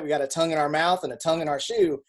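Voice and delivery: normal talking voice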